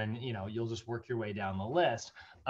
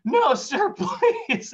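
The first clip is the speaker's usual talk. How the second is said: much higher, louder begging with a laugh; pleading telemarketer; 13 semitones higher, 8 dB louder, much slower than usual. Retold or imitated. Exasperated caller begs the dad, in a high, loud plea. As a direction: plead with him.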